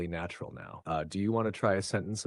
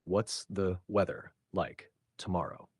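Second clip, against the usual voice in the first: slow, even, syllable by syllable; slowed-down teacher model sentence. Shown as a demonstration. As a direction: say it slowly for the learner. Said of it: "Try saying it slowly with me".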